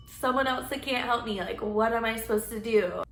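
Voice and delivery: ordinary talk, nothing marked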